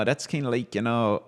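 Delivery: ordinary talk, nothing marked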